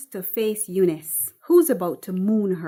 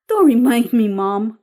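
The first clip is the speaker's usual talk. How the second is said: grimacing daughter; 2 semitones higher, 9 dB louder than usual. Acted. Grimaces Patrice.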